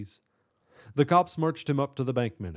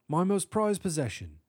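high, proud British professor; higher-pitched British accent, prideful; 6 semitones higher than usual. A narrator's reading. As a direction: boast gently in a British accent. Professor proud of his orb.

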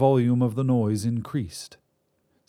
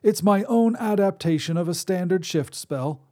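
put-on higher reedy old man's voice; reedy old wizard; 8 semitones higher than usual. Wizard voice held.

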